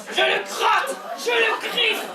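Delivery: high, fast, shouting frenzy; frenzied rant. Acted. Cook scratching her lover, shouting in wild frenzy. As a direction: rattle it off like a woman gone wild.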